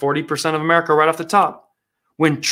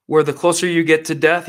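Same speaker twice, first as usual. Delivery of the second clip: ordinary delivery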